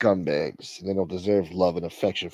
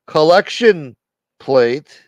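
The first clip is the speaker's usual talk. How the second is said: called out; 5 semitones higher, 9 dB louder, much slower than usual. Pretend. Preacher calling out collection plate.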